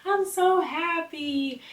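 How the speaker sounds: put-on high, slow, delighted child voice; lightly voiced happy daughter; happy little girl. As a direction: imitate a joyful little girl. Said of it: "Parker was just like".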